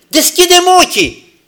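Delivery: high, loud plea; a sinner shouting to God for sight; crying out. Retold or imitated. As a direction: cry it up to God. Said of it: "Și strigând".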